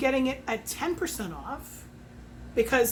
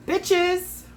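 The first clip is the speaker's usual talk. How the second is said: excited shout; 7 semitones higher, 4 dB louder than usual. Real, not acted. Excited cheer over matching colors.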